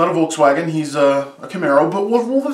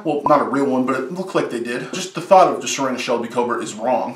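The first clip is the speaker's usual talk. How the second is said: ordinary delivery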